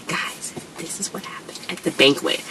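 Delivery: whispered, as if sharing gossip; confiding whisper. Real, not acted. Telling viewers what happened at the banquet, whispered. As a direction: lean in and whisper the big reveal.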